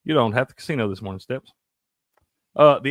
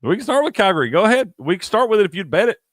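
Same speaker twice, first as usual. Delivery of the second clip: sarcastic; 9 semitones higher, 6 dB louder than usual. Real, not acted. Teasing the partner over his bet.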